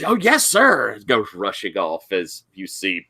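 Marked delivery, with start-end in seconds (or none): 0.0-1.0 high, keen student voice; eager kid. Acted. Student accepting the doctor's errand, high and eager. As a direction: chirp agreement like an eager teen. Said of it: "the kid goes".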